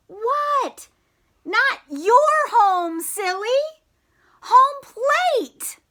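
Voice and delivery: high, loud playful yell; calling out. Acted. Frogalina shouting to Froggy across the field, high and loud. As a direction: call him back, teasing.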